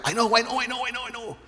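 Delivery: high, eager blurting; eager I know. Acted. Peter eagerly blurting out like an impetuous student. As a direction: blurt it like the kid who knows the answer. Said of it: "being impetuous as he always was".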